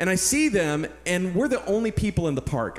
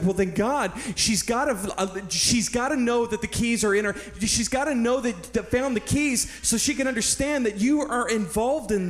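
higher-pitched, urgent pleading with God; urgent prayer; 6 semitones higher than usual. Retold or imitated. Hiker praying to God for the woman's keys, urgently. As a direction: plead it in a rush of conviction, stumbling over restarts. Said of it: "I felt this tremendous urgency".

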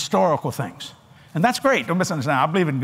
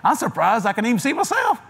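higher, laughing voice, amused at the dark; laughing mock God; 7 semitones higher than usual. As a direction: play God chuckling in amazement. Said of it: "God voiced, laughing".

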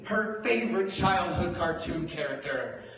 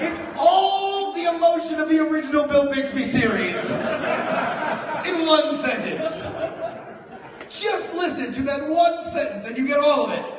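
loud and much higher, laughing; excited rave; 11 semitones higher, 8 dB louder than usual. Real, not acted. Comic raving to audience about the friend's line, loud and excited. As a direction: gush about it, near shouting.

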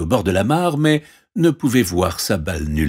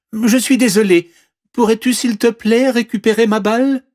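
high, loud pleading voice; pleading princess; 5 dB louder than usual. Acted. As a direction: beg urgently as a princess. Princess begs frog for ball. (voix aiguë et suppliante de princesse)

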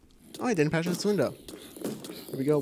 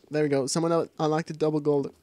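ordinary talk

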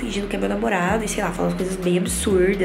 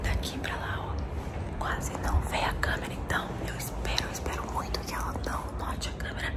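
hushed whisper so mom won't hear; 4 semitones lower, 12 dB quieter than usual. Real, not acted. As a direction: whisper it, hiding from the next room. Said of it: "tô falando baixo porque ela não viu".

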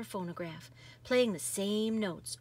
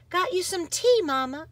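put-on higher, lighter child's voice; bright girl voice; 9 semitones higher, 7 dB louder than usual. Girl offering tea to her tired mama, higher and eager. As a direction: sound like an eager little girl.

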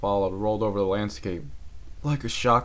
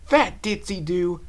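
higher, louder, slow grumble; annoyed grumble; 10 semitones higher, 6 dB louder, slower than usual. Acted. Rainbow Dash grumbles about Ditzy Doo. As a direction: curse a clumsy teammate. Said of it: "Dash muttered".

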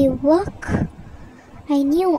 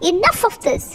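higher-pitched, impatient order; barked order; 6 semitones higher, faster than usual. Acted. Henry breaking up the bet, barking. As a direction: cut them off sharply. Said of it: "Henry barked".

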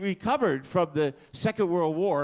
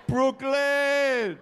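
excited yell; 9 semitones higher, 4 dB louder than usual. Real, not acted. Reacting to Brooklyn answer.

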